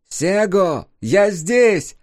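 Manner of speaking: high, bright ringing call; clear ringing voice. A narrator's reading. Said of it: "повторил голос".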